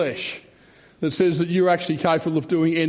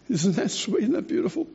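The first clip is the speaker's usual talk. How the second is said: tender awe; 6 semitones higher than usual. Real, not acted. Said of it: "admiring the verse".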